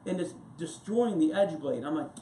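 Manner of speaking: usual speaking voice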